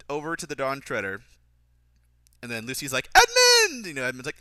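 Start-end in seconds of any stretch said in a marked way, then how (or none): 3.1-3.9 very high, loud imitation of a girl calling; hammed-up high-pitched yell; mock scream. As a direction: shriek the name in parody.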